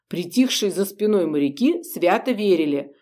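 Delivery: ordinary delivery, nothing marked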